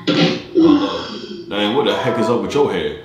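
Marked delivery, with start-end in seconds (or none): 0.1-1.5 squeaky hello. Acted. Switches voice greeting a stranger.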